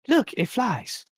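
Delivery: high, delighted showing-off voice; high toymaker voice. Acted. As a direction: squeal it like a proud toymaker. Toymaker shows bat mobile.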